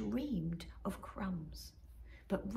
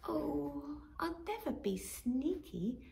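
sighing; 6 semitones higher, 4 dB quieter, slower than usual. Acted. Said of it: "sighed Robin".

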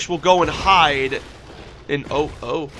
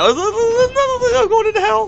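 much higher, laughing squeal; laughing hysterically; 4 dB louder than usual. Real, not acted. Losing it while playing, high laughing squeal.

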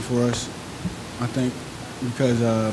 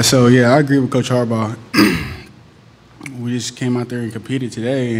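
ordinary talk

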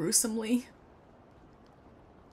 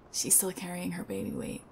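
usual speaking voice

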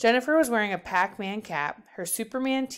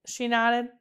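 ordinary delivery

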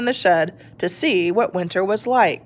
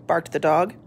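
plain reading voice

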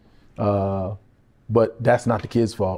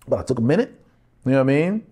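ordinary talk

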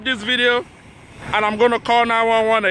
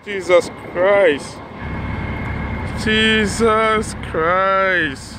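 stunned dismay; much slower than usual. Real, not acted. Watching the crash unfold.

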